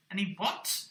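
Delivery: ordinary delivery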